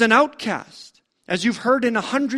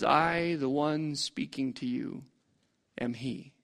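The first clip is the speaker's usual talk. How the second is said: softer with each word, down to a hush; solemn hush; 6 semitones lower, 9 dB quieter than usual. Acted. Jesus revealing himself to the woman, solemn hush. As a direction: let the revelation fall quietly.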